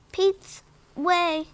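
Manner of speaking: ordinary delivery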